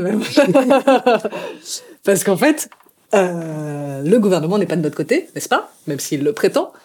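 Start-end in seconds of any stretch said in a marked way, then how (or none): 0.3-1.8 wry laugh trailing off; bitter laugh. Real, not acted. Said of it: "ça me fait rire jaune".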